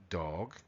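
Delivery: Dog low and soft with a long o; DOG, long vowel. Shown as a demonstration. Teacher repeating the contrast with duck, low and long. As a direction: draw out Dog quietly, ending in g. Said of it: "oís la diferencia".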